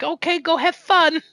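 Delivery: high sing-song send-off, imitating a parent; mock-parent cheer, pitched well up; indulgent parent. Player imitating co-op partner as parent letting child loose, indulgent. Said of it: "I'm playing with my parent".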